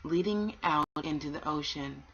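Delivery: put-on gentle read-aloud for children; a calm storyteller reading aloud; storybook reading. A narrator's reading. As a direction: tell it like a bedtime story.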